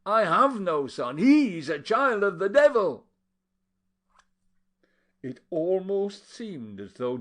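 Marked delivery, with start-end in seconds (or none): 0.0-3.1 loud, high, furious denial; angry outburst. A narrator's reading. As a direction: rage at the mere idea. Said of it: "exclaimed the other angrily".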